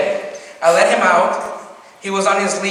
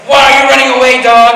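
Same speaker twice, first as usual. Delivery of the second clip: higher, much louder mock scolding; imitating an angry mom; 5 semitones higher, 11 dB louder than usual. Mom yelling at the runaway dog, angry. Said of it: "my mom would get so mad".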